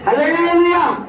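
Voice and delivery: high, loud call to the crowd; loud hallelujah, fired up; hallelujah shout. Real, not acted. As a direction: ring it out over the congregation. Preacher calls out to congregation.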